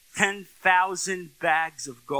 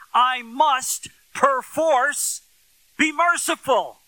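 preaching at full force; 8 semitones higher, 4 dB louder than usual. Acted. Preacher pressing the congregation on mercy, rising to full preaching. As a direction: build the sermon to its peak. Sermon climax.

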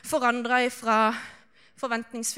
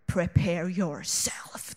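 slow, low, self-important thought; dramatic inner voice; 7 semitones lower, 4 dB quieter, much slower than usual. Retold or imitated. Performer psyching up before the act, dramatic low inner voice. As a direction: drag it out low like a grand warning. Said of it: "tenkte jeg".

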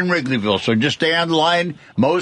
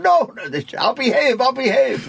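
put-on high, frantic begging child, much higher; pleading kid; 10 semitones higher than usual. Boy begs his uncle the judge, very high pleading. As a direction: beg not to be locked up. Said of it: "I said".

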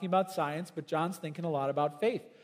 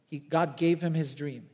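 ordinary talk, nothing marked

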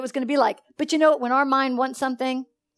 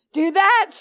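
higher, louder payoff; punchline answer; 7 semitones higher, 6 dB louder than usual. Real, not acted. Preacher answers own question.

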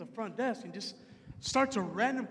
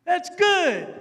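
loud, higher bubbly reply; 5 semitones higher, 7 dB louder than usual. Retold or imitated. Bubbly stranger answering weary man, loud and high. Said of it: "was bubbly".

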